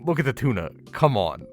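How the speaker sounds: ordinary delivery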